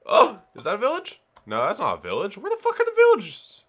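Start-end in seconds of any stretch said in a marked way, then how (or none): 0.1-1.2 high, loud burst of excitement; too excited. Real, not acted. Player thinks a village is in sight, excited outburst. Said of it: "I get too excited whenever I see". 2.4-3.6 very high, loud outburst; frustrated yell. Real, not acted. Player's false alarm over a village, high exasperated yell.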